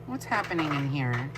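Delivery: ordinary talk, nothing marked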